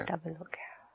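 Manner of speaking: soft whispered repeat; hushed agreement; whispered echo. Real, not acted. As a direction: breathe the words back. Echoing partner in a whisper.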